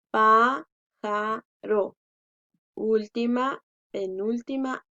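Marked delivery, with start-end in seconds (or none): 0.1-2.0 pájaro in three slow separate beats, "pá, ja, ro"; slow dictation of pájaro with pauses between syllables. Shown as a demonstration. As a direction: break pájaro apart, strongest on "pá". Teacher splitting word into syllables.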